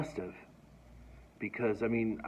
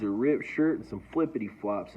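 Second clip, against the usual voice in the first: usual speaking voice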